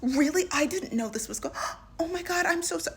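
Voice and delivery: over-the-top shocked sympathy, high pitched; gushing woman. Retold or imitated. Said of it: "And she's just".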